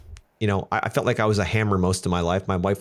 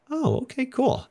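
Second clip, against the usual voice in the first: much higher, light mock-cheerful voice; mock chirpy colleague; slower than usual. Becky answering his question, mock cheerful and much higher. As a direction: imitate her bright, easy acceptance. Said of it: "She'll be like".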